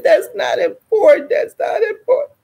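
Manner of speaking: high-pitched mock-tearful protest; wailed protest. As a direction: whine it high, rising on the repeat. Her wailing protest to doctor.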